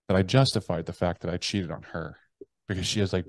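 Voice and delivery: ordinary delivery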